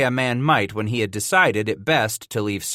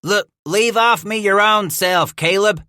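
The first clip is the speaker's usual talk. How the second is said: grumbling; 10 semitones higher, 5 dB louder than usual. A narrator's reading. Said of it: "Hatcher grumbled".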